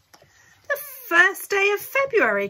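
high, loud excited outcry; excited cry. A narrator's reading. Mrs Bucket realising the visit is tomorrow, high excited cry. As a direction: cry out the date in sudden alarm. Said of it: "cried Missus Bucket".

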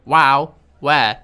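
Wow, where? shouted with a hard break between the two words; loud contrast. Shown as a demonstration. Yelling at the game so it hears wow and where apart. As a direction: bark wow, then bark where. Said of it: "Different words".

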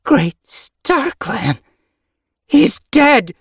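high, loud, drawn-out wail of shock; horrified cry. A narrator's reading. Kit finding the apprentice's body, horrified cry. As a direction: cry out in horror at a death.

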